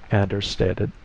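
plain reading voice

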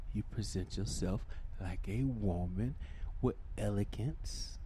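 usual speaking voice

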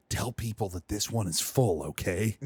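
low, hushed undertone; confidential aside. A narrator's reading. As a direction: slip it quietly to a stranger. Stranger's confidential request, read aloud.

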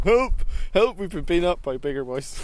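high, loud mock shout for help; retold cry for help. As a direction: call out "Help!" like a frightened teen at a door. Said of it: "knocked at somebody's house door and said".

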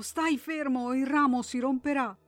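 high, loud panicked commands; frantic yelling. A narrator's reading. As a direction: order him to stay still, terrified. Mother yelling at child. (madre terrorizzata che urla al figlio)

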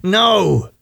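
sudden loud, high snap. A narrator's reading. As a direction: blurt it out too loudly. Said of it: "louder than he intended".

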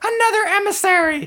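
the attendant squeaks "Master Zarek!" very high. Acted. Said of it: "speaking two octaves higher".

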